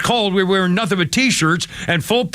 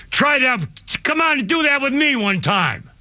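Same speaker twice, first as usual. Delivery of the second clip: high, heated dare; angry challenge; 6 semitones higher than usual. Real, not acted. Ex-Marine daring them to try that with him, angry. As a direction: throw out a high, indignant challenge.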